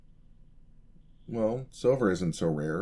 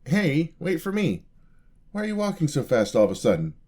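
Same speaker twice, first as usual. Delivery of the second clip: calling after; 8 semitones higher, 5 dB louder than usual. Acted. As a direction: shout after her as she hurries off. Calling after her walking away.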